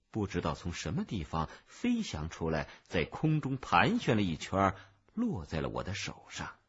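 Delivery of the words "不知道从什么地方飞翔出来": plain reading voice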